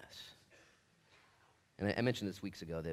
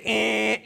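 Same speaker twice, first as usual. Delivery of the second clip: loud, high "eh" buzzer noise; blaring imitation buzzer; wrong-answer buzzer; 13 semitones higher, 16 dB louder than usual. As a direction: buzz them like a game-show wrong answer. Buzzer noise rejecting the idea.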